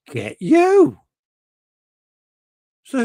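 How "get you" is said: high, loud mock-impressed; teasing. Real, not acted. Teasing a viewer about his newspaper column. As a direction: tease him, impressed.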